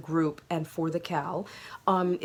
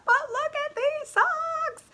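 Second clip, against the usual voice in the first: very high excited squeal. Real, not acted. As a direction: squeal with delight. Squealing showing socks.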